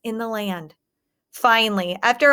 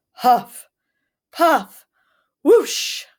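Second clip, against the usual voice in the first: huffing and puffing; 4 semitones higher, 5 dB louder, much slower than usual. A narrator's reading. Wolf blows the house down.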